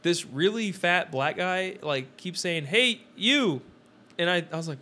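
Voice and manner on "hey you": higher mock stranger's call; retold calling out. Stranger hailing him in the store, mock calling out. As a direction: imitate a man calling out.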